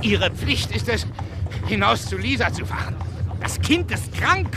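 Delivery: put-on angry friend shouting at a drunk father; urgent shout. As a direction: hammer home that the child is sick.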